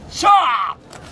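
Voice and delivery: long drawn-out war shout; battle cry. Acted. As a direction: charge in with a yell. Charging into battle. (雄叫び)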